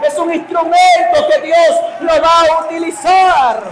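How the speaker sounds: loud, high, full-force preaching; fiery preaching. Acted. Preacher to his church, building to a loud, high shout. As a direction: drive it home to the congregation.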